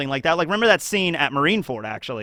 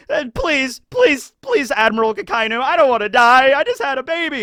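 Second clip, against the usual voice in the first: higher, pleading whine; hammed-up terrified soldier; mock begging; 7 semitones higher than usual. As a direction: beg the admiral for your life.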